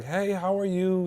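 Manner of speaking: lightly voiced sweet old lady, high and soft; calm sweet old lady. The DMV clerk Lori answering his call, lightly voiced calm and sweet. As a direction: greet him gently like a kind clerk. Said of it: "So calm".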